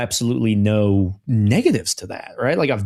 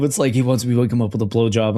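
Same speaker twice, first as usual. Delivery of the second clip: ordinary talk, nothing marked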